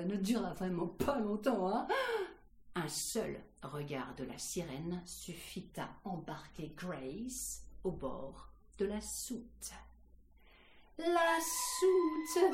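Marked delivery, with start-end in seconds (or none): 2.7-9.7 low, soft, slowing storyteller; hushed narration. A narrator's reading. Storyteller lowers voice before the hold. 11.0-12.4 high, held storyteller cry; high-pitched repeat. A narrator's reading. Repeated high, announcing piercing sounds.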